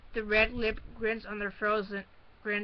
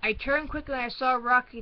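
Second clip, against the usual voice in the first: plain reading voice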